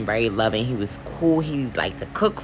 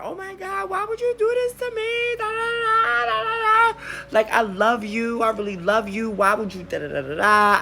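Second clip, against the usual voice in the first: high wailing sob, much higher; hysterical crying. Retold or imitated. Crying and pleading with the boyfriend who trashed her house. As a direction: wail and plead through tears. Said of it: "So I'm in there".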